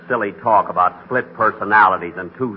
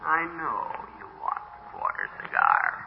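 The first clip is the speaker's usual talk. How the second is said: insinuating inner voice; 4 semitones higher, 11 dB quieter than usual. Acted. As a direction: coax him softly as his other self.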